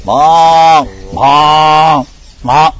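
loud, high cow moo; cows mooing in distress. Pretend. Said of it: "Cows crying in distress".

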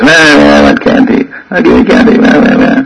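whiny mock child wailing for candy; hammed-up fake kid tears; fake child crying for candy. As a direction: imitate a child's put-on whimpering. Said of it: "he goes".

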